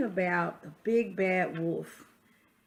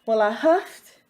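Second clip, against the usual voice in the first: lively read-aloud as the wolf, a touch louder; story narration; 5 semitones higher, 7 dB louder than usual. Acted. Reading a picture book to children, storyteller voice. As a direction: tell it like the wolf defending himself.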